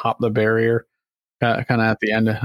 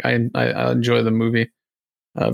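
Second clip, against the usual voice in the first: ordinary talk, nothing marked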